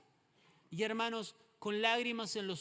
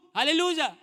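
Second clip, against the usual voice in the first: shouted aleluya; 9 semitones higher, 14 dB louder than usual. Real, not acted. Preacher exclaims.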